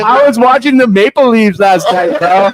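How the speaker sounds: put-on bright, high Canadian accent. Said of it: "I want that voice".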